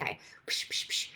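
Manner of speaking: soft breathy psh psh into an ear; whispered shushing. Retold or imitated. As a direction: breathe a gentle whisper sound. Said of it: "put a little whisper in her ear".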